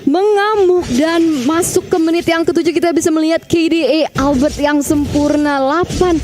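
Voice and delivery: ordinary talk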